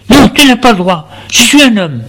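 high, pleading shout of protest; son crying out. Acted. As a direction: yell back at the father, high. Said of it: "Lui crie".

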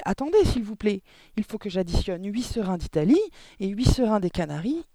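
loud, high, urgent pleading; panicked cry. A narrator's reading. Pupil trying to add up under pressure, panicked cry. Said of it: "cria-t-il".

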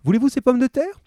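high, lightly voiced shopkeeper; friendly grocer. A narrator's reading. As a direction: chat like a helpful grocer. Grocer asks.

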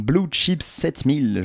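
English title said à la française; French pronunciation. Real, not acted. Host sparing listeners his English accent, French pronunciation. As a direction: read "Blue Chip sept mille" with a French accent. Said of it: "Je le prononce à la française".

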